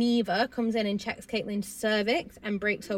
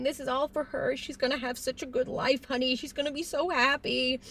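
higher, a touch of tears; tearfully comfort, lightly acted; mild mock sobbing; 5 semitones higher than usual. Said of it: "Tyler's sobbing into her".

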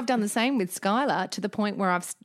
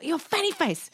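high mock-cross kid voice; pitched-up childish taunt; child's insult; 7 semitones higher than usual. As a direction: imitate a small boy name-calling.

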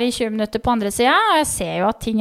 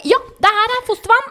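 much higher, bright mock midwife cheer; chirpy midwife; 11 semitones higher, 4 dB louder than usual. Midwife cheerfully praising the mother, high mock voice. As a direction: imitate the upbeat midwife, pitched way up. Imitating midwife sniffing pad.

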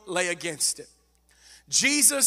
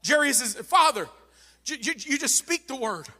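raised, urgent preacher's voice, stumbling over words; fervent preaching plea; 4 semitones higher, 4 dB louder than usual. Acted. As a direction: plead with Jesus as a fired-up preacher.

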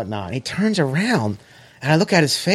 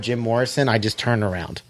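ordinary talk

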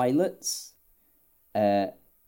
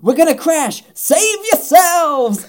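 loud, high-pitched hammed-up yell, last words drawn out; over-the-top panic; 8 dB louder, slower than usual. Tram driver to passengers before the crash, over-the-top panic. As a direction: warn everyone in comic terror. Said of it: "in this really over the top way".

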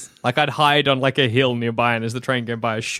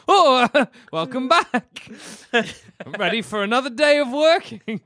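cheery train voice; 9 semitones higher than usual. Acted. Train voice, higher, slow.

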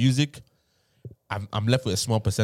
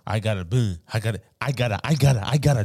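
put-on American accent, getting louder; exaggerated American twang for a joke; mock American accent; 5 dB louder, faster than usual. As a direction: parody an American accent, repeating it insistently. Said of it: "Or they're born in America".

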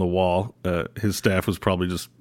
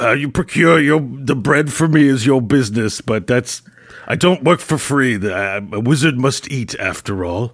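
put-on haughty old wizard; 3 semitones higher than usual. Archie haughty.

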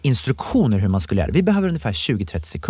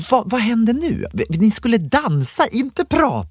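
high-pitched mock dismay; exasperated; 11 semitones higher, slower than usual. Organiser reacting to wrong birthday videos, exasperated. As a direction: protest in a high, incredulous voice.

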